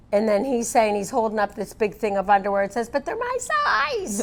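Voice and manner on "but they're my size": high, slow cartoon hedgehog; squeaky hedgehog voice. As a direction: pitch way up for a tiny critter. Voicing a hedgehog in a picture book.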